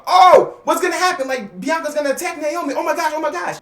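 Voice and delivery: high, shocked crowd gasp; high excited gasping of a shocked crowd. Retold or imitated. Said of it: "whole crowd is gonna go like".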